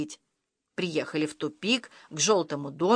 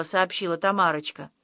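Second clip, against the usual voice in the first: ordinary delivery, nothing marked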